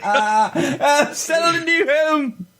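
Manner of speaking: high, laughing mimic of a mother; mock mom voice. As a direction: echo the pioneer mom, squeaky and amused.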